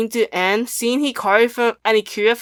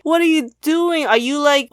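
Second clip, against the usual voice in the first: high-pitched imitation of incredulous teammates; mock disbelief; 6 semitones higher than usual. Teammates challenging the team leader, mock disbelief. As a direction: question him in put-on alarm, pitched up. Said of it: "everyone is going crazy wild".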